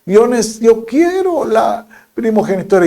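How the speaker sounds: high, pleading protest of a regretful son; pleading Esau. Retold or imitated. Esau begging his father for the birthright, high pleading. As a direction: beg to have it back. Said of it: "él dijo".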